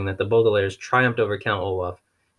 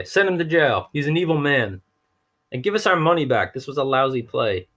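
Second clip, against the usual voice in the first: angry heckling; 5 semitones higher than usual. Acted. Audience heckling Olaf.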